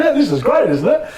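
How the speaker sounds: high-pitched giddy inner voice; hammed-up starstruck thrill; mock delight. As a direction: squeal it to yourself, sending up your own awe. His giddy inner voice, retold amid laughter.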